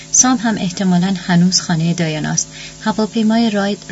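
ordinary delivery, nothing marked

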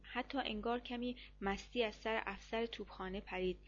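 ordinary delivery

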